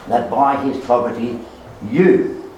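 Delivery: pause, then land firmly on "you"; stress on you. Shown as a demonstration. Preacher shows the verse's second pronoun, emphatic you. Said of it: "there are two personal pronouns".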